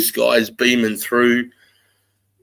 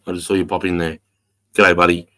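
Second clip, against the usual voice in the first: ordinary talk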